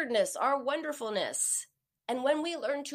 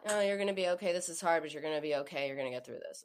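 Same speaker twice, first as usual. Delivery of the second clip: glum reassurance; 6 semitones lower, much faster than usual. Shown as a demonstration. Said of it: "Would you say that to someone you care about?".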